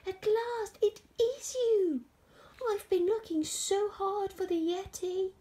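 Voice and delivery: high, loud, relieved cry; overjoyed. Acted. George believes his long search is over, high and overjoyed. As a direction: call it out high in delight.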